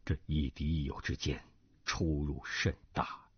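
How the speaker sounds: low, sighing inward calculation; brooding schemer's inner thoughts. Acted. Cui weighing whether to apologise to the Duan family, low and brooding. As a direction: think it through gravely, far below the narrating pitch.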